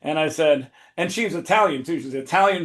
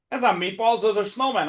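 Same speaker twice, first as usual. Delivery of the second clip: a touch of indignation, pitched up; imitated objection; 6 semitones higher than usual. New York opponent correcting the hand's nickname, mildly indignant. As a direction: mimic her correcting him, higher voice. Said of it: "And she's like".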